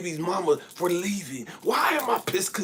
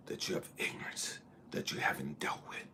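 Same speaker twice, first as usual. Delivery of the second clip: whisper; 15 dB quieter than usual. Real, not acted. Hushed closing of point.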